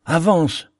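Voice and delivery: « avance » relancé plus haut, plus insistant; répète « avance » en montant, l'urgence grandit; vivement, plus pressant. A narrator's reading. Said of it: "dit vivement l'ermite". (l'ermite insiste auprès du pilote : « avance » plus haut et pressant)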